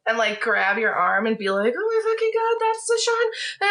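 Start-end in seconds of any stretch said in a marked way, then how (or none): 1.8-3.7 excited fangirl squeal, very high; really high-pitched. Acted. Fan grabbing a friend's arm, high-pitched squeal. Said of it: "a really high-pitched voice".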